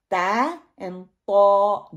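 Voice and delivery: تا light then طا heavy, very slow; thin vs heavy contrast. Shown as a demonstration. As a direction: draw out تا and طا to contrast. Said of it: "دونوں میں فرق ہے". (باریک اور موٹا فرق)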